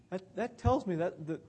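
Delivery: normal talking voice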